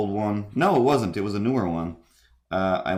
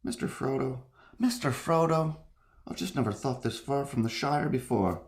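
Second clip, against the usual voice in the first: high, soft hobbit voice, searching for the character; Samwise; 6 semitones higher, 5 dB quieter than usual. Acted. Trying out the loyal hobbit addressing Mister Frodo, Samwise impression. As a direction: put on a hushed, higher-pitched loyal companion. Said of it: "How does Samwise sound?".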